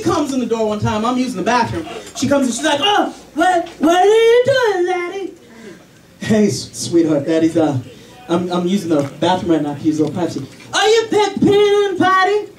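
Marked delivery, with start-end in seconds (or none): 2.8-5.3 put-on very high curious little girl; toddler voice. Daughter walking in on dad, high toddler voice. 6.2-10.5 low, awkward embarrassed dad; flustered dad. Retold or imitated. Dad caught in bathroom by daughter, low and flustered. 10.7-12.5 put-on very high curious little girl; toddler voice. Daughter walking in on dad, high toddler voice.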